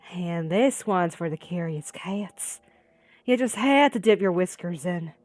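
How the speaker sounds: a sinister killer's sneer; taunting gunman. Acted. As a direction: toy with the victim before the shot.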